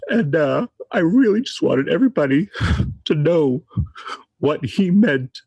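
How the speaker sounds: tearful, halting grief with long pauses; real crying. Real, not acted. Friend telling everybody what he meant to him, crying. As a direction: push through the tears to finish. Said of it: "I'm sorry for crying".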